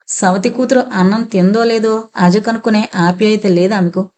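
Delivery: ordinary delivery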